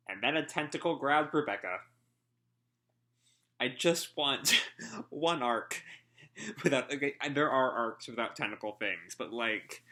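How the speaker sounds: normal talking voice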